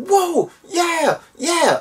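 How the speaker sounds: high, hammed-up whooping like an excited fan; mock-holler it way up high; whooping cheer. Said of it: "he's cheering, he's whooping, he's hollering".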